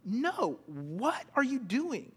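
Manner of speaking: high, appalled objection; indignant. Acted. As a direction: object in disbelief, drawing it out. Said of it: "That's Jesus' feeling here".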